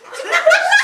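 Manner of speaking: very high, loud comic squeal; squealed oath. Acted. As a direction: squeak out the vow in falsetto. Trapped devil squeals his oath.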